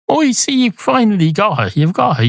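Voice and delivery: lightly voiced cat, pitched high; high, mildly triumphant exclaiming; delighted cat. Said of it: "And the cat says".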